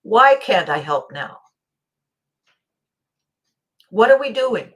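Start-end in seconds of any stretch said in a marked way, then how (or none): 0.0-1.4 put-on louder, slower child's protest; the child inside demanding to help; a touch of protest. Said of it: "inside I'm saying".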